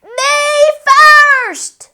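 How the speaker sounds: very high loud shriek. A narrator's reading. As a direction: shriek at full gallop. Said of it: "Pinkerton shrieked".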